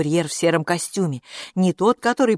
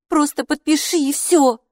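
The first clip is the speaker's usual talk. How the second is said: higher, louder coaxing; a daughter urging her mother; impatient girl; 10 semitones higher, 4 dB louder than usual. A narrator's reading. Hilla tells mom to just sign, higher voice. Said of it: "сказала Хилла маме".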